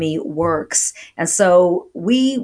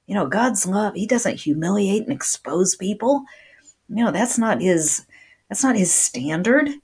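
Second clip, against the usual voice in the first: normal talking voice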